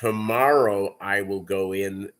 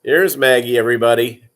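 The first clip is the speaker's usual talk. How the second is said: announcing; 10 dB louder, faster than usual. Real, not acted. Presenting dog to viewers.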